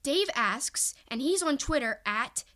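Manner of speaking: ordinary talk, nothing marked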